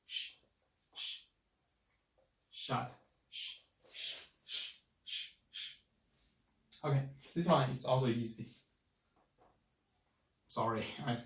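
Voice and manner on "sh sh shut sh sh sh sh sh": "sh" hushed and unvoiced, fading softer; sh like shut. Shown as a demonstration. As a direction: hiss sh out quietly, no voice. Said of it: "Like shut up".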